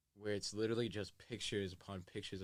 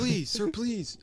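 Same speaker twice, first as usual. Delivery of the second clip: much higher, louder pleading; mock pleading; 12 semitones higher, 8 dB louder, slower than usual. Passive aggressive robber begging, pitched-up mock plea. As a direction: beg like a polite robber.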